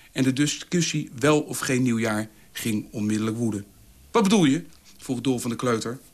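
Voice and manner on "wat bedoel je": hoog, luid opschrikkend; verbaasd. A narrator's reading. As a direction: schiet verontwaardigd uit. Dolf schrikt van kleutervraag. (geschrokken uitroep van Dolf)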